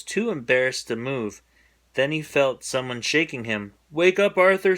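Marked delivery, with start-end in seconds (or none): 3.9-4.8 higher, louder call to wake. A narrator's reading. Buster waking Arthur after the movie. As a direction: rouse him firmly. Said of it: "said Buster".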